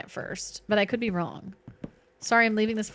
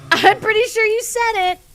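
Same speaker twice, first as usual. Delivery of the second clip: mock accusation; 9 semitones higher, 6 dB louder than usual. Teasing a friend back.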